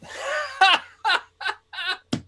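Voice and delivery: loud hearty laugh; big booming laugh. Acted. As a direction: throw back a big laugh. Big laugh after mocking.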